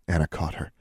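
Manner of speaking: ordinary delivery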